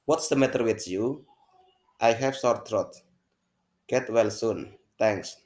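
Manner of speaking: bright, performed classroom dialogue; acted dialogue reading. Teacher reads the Wisnu and Kinar dialogue to the class, acted out. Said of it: "saya ulangi sekali lagi".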